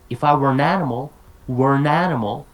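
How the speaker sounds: "were an animal" run together as one word; linked words. Shown as a demonstration. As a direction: link "were an animal" with no breaks, quickly. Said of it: "They put three words together".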